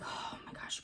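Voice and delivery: breathy, voiceless "oh my gosh"; exasperated whisper. Retold or imitated. Wife dismayed that the chat line is closed, exasperated whisper. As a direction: sigh it out under the breath. Dismay at limited service hours.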